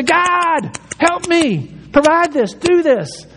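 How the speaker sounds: put-on high, small voice of a child asking; childlike plea. Imitating a child begging God for help. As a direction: ask like a little kid tugging a sleeve. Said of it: "go to God like a kid".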